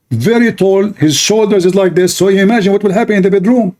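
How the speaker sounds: ordinary delivery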